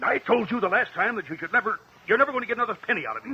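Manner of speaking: furious shouting at the blackmailer; angry outburst. Acted. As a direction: explode at her, rushing the words. Blows up at blackmailer.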